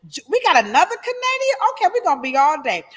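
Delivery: fast, high and lively; tumbling words, half laughing at the crowd; rushed. Real, not acted. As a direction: hurry through the shout-outs. Rushing through viewer shout-outs.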